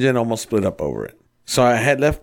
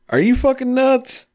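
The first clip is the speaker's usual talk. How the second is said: high, incredulous mock yell; incredulous outburst. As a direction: retell your disbelief big and high. Own retold reply in fight.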